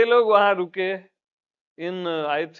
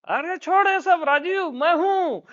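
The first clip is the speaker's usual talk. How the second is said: much higher, brushing-off mock mother voice; blunt mom imitation; 11 semitones higher than usual. Mother dismissing Rajiv, in a high blunt mock voice. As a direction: wave Rajiv off in her voice. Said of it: "वो सीधा बोलती है".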